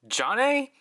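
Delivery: "Jane" as a high, rising question; alternative pronunciation. Shown as a demonstration. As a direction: try out "Jane" with pitch swept upward. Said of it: "other pronunciations".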